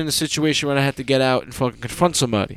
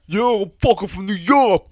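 put-on slow, higher-pitched caricature New York accent; mock New Yorker; 5 semitones higher, slower than usual. West Coasters doing us, mock New York accent. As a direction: drag it out like a parody New Yorker. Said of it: "when they do us, they talk like".

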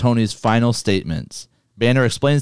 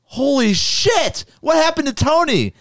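shocked. Retold or imitated. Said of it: "you're thinking".

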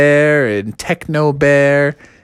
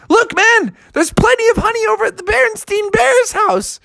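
Froggy voice. Acted.

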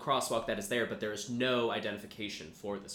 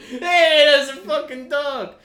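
loud, high, hammed-up holler; family dog's voice; 16 dB louder, slower than usual. Asked for the dog's reaction, gives a loud mock holler. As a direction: bark out the words in the same caricature voice. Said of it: "What's the dog like?".

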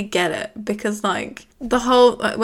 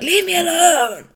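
mock shout; 8 semitones higher, 3 dB louder than usual. Voicing Mike shouting.

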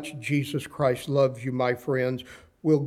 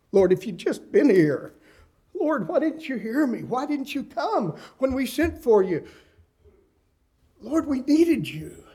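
anguished plea; 9 semitones higher than usual. Acted. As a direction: voice climbing much higher in grieving reproach. Grieving sister pleads with Jesus.

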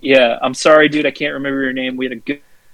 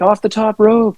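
high-pitched, hyped ringside call; hammed-up wrestling excitement; mock wrestling call; 11 semitones higher than usual. Acts out a wrestling tag-team move.

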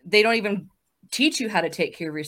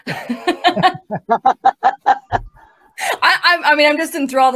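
high, loud laughing words; 7 semitones higher, 8 dB louder than usual. Real, not acted. Host laughing while admitting she is enthralled. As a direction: laugh through the words, delighted. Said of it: "that's my cue".

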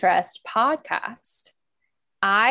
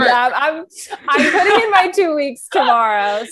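nervous laughing; 7 semitones higher, 8 dB louder than usual. Real, not acted.

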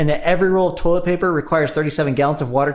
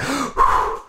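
gasp; 10 semitones higher than usual. Acted. Gasp in ad read.

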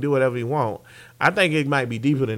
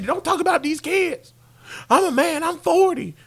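shouted, much higher coach rant; mock furious press-conference shout; imitating Mike Gundy; 13 semitones higher than usual. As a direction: yell it like an offended coach. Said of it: "Mike Gundy, bro".

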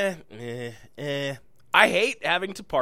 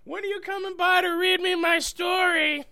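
high, whiny fan voice; 4 dB louder than usual. Retold or imitated. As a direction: play an eager needy fan.

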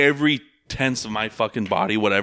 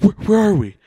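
panicked; 11 semitones higher, 7 dB louder than usual. Retold or imitated. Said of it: "Like, freaking out".

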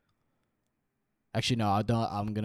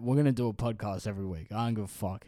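ordinary talk, nothing marked

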